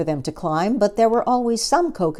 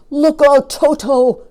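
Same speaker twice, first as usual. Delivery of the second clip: much louder, higher alarmed shout; shouted warning; 8 semitones higher, 10 dB louder, slower than usual. Acted. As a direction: yell a warning across the clearing. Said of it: "shouted Humpy Rumpy".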